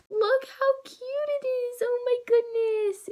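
high delighted gushing; excited squeal. Real, not acted. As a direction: coo over the cute squishy. Showing a cute squishy.

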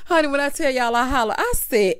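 usual speaking voice

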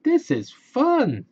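high, loud happy exclaim; delighted cry. Acted. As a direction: burst with fun. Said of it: "says Ralph".

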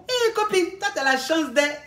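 high, delighted Eh to the friend; excited exclaim. Acted. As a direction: squeal the greeting like a thrilled friend. Friend reacting to the brag.

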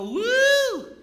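high loud whoop. Retold or imitated. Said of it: "they go".

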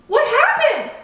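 high, loud, alarmed question; calling out. A narrator's reading. Keeper running up after the crash, calling out. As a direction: call out in sudden concern. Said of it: "the lighthouse keeper called".

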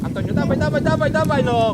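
loud urgent cheering; shouting. Real, not acted. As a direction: drive the player forward. Coach urging a player.

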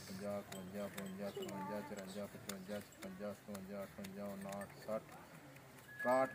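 low, very soft counting; counting under breath. Real, not acted. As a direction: count banknotes quietly to yourself. Counting out cash notes on camera. (ਹੌਲੀ ਆਵਾਜ਼ ਵਿੱਚ note ਗਿਣਦੇ ਹੋਏ)

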